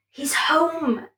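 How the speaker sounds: usual speaking voice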